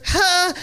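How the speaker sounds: very high mock "ha"; fish's gasp. Comic voicing tilapia put on the grill, high gasp. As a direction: puff out a tiny high exclamation as the fish. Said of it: "it goes".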